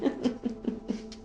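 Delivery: high, airy giggle tailing off; real leftover laughter; trailing laughter. Real, not acted. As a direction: keep giggling as it subsides. Laughter carries on after remark.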